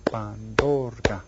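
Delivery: slow "Pandorga", leaning on "dor"; stress on second syllable. Shown as a demonstration. Teacher asks which is stressed, "Pandorga" slow with "dor" held. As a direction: stretch "Pandorga" with weight on the middle syllable. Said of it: "which one is stressed?".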